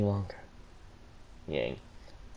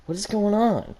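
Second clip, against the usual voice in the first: high demand; 12 semitones higher than usual. Acted. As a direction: push for the answer. Ruby demands answer.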